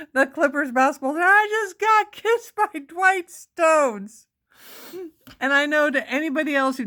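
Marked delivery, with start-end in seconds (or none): 1.2-4.3 high, loud, drawn-out re-enacted fan scream; screaming in excitement. Woman re-enacting running off after the kiss, excited scream. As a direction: holler it slowly and high, like announcing it to a whole stadium. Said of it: "I was screaming at the top of my lungs".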